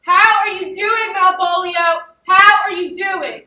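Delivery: loud, high and slow, like speaking to a madman; loud mock concern. Plotter loudly greeting the supposed madman with mock concern.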